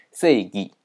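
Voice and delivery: 正义 read as seigi; Japanese reading. Shown as a demonstration. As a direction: say 正义 the Japanese way, sei-gi. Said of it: "在日文当中念作".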